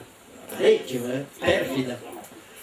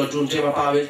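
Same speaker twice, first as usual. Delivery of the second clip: ordinary delivery, nothing marked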